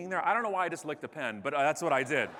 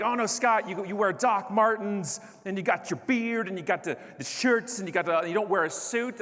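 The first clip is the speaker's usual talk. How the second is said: raised-pitch, insistent mock admirer; gushing objector; 4 semitones higher, 4 dB louder than usual. Audience member telling speaker his look is a brand, mock insistent. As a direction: pile up the points in a higher, pushy voice.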